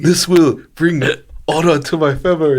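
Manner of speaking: put-on solemn hammy Japanese accent. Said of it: "a racist accent".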